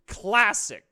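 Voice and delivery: loud, punchy verdict; emphatic verdict. Real, not acted. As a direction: slam down the word classic. Emphatic one-word verdict.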